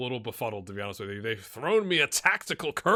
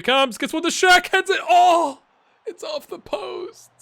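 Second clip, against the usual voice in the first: climbing to a much higher, louder yell; excited shout; 12 dB louder than usual. Real, not acted. Cheering his striker's header onto the post, excited yell. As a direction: erupt as the header comes in.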